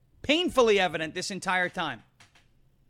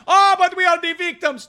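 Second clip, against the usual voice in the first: whiny mock victim; 9 semitones higher, 9 dB louder than usual. Mocking whining terrorists.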